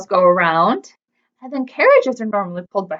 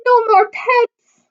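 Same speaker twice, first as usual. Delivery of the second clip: wailing; 8 semitones higher than usual. A narrator's reading. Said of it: "wailed Bob".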